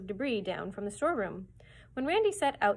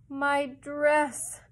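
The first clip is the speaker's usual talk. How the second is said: moaning; 6 semitones higher, 8 dB louder than usual. A narrator's reading. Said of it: "moaned Mona".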